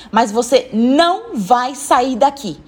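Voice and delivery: slow, emphatic prohibition, each word weighted; firm refusal. Acted. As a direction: lay down the law on "não vai sair". Woman lays down the law. (proibição firme e pausada)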